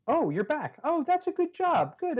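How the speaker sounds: put-on high, encouraging kid-presenter voice; child video host. Child welcomes imaginary video viewers back, encouraging host voice. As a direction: imitate a kid praising viewers, pitched well up.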